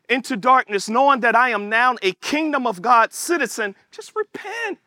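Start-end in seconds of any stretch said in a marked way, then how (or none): 3.9-4.8 high, soft urging; hushed urging. Real, not acted. Preacher urging listeners to repent, hushed and high. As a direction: urge it high and softly.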